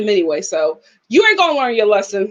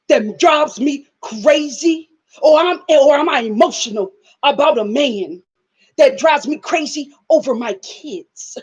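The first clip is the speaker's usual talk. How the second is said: mock tearful Sarah. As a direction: overact Sarah's wounded confession. Mimics Sarah's tearful confessional.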